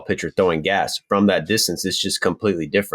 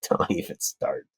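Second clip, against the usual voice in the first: whispered, much softer; hushed; 3 semitones lower, 7 dB quieter, slower than usual. Real, not acted. Teased co-host cutting off the pronunciation jab, whispering. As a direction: breathe it out under your breath. Ribbed about his pronunciation.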